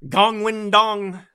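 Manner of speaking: "Gong Win Dong" drawn out slow, every syllable spelt out; exaggerated American reading. Shown as a demonstration. Host mocking the name for his listeners, slow and loud. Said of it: "I'm, I'm American".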